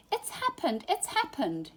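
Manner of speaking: high, excited announcing call; excited shout. A narrator's reading. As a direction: burst in with the big news, high and eager. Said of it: "he shouted".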